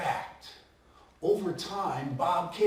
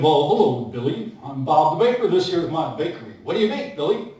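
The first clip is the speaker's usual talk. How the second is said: put-on lower, older baker voice; kindly old baker Bob, lower voice; 6 semitones lower than usual. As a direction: play the old baker, pitched down.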